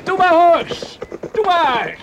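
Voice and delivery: high, loud encouragement mid-race; cheering the horse. Acted. Racing horses on the beach. (hoog en luid het paard aanmoedigen)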